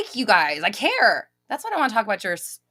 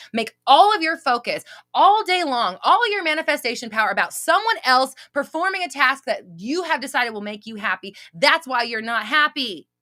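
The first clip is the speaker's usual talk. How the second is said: rising to a loud, higher scolding; heated rant; 5 semitones higher, 5 dB louder than usual. Real, not acted. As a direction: tell them off, building louder. Ranting at viewers about SPs.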